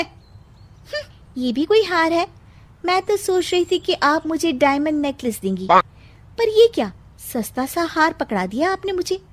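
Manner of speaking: put-on high, sneering young bride; spoilt bride. Bride mocks mother-in-law's cheap necklace, high sneering voice. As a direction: turn your nose up at the gift.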